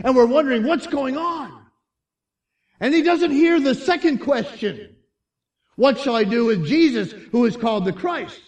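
loud, high preacher's delivery; full-force preaching. Real, not acted. Preacher retelling Barabbas's story to the congregation, full force.

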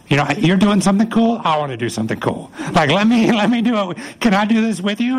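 high-pitched excited pleading; eager excitement. Acted. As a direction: imitate an eager disciple begging to join in. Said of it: "see the excitement in his face".

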